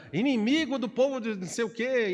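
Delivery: hammed-up high scolding; mock insult. Preacher imitating an angry driver insulting someone, mock scolding. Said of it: "vai falar".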